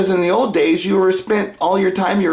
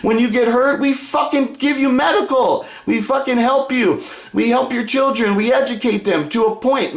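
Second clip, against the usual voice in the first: rising mock rant, higher pitch; voicing angry rulers; 5 semitones higher than usual. Rulers mocking the ungrateful, in a rising angry rant. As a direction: snarl back as the people who feed you. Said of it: "they're saying".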